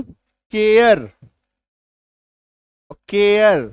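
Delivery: केयर said slowly with an open vowel; English vowel demo. Shown as a demonstration. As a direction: draw out केयर with an open mouth. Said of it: "फिर से मैं बोलता हूं".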